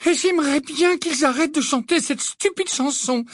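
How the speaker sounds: high-pitched, afflicted complaint; pained tone. A narrator's reading. Hermione wishing they'd stop that stupid song, pained. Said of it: "dit Hermione d'un ton affligé".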